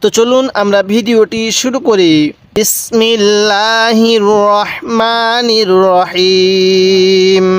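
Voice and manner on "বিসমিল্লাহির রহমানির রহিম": slow, drawn-out Quranic recitation; chanted basmala, very slow. Real, not acted. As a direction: intone the basmala slowly and reverently. Opens Quran lesson with basmala.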